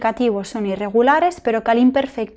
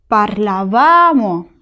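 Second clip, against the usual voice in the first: "parlavamo" slowed, VA stretched and raised; stress on va; 3 dB louder, much slower than usual. Shown as a demonstration. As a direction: hold the VA of "parlavamo" long. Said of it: "la sílaba tónica es va".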